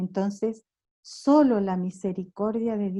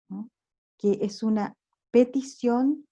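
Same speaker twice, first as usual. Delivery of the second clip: usual speaking voice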